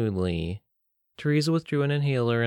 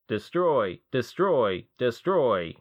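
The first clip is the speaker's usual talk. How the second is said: robot voice yell; 3 semitones higher, much slower than usual. Acted. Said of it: "the robot voice yelled".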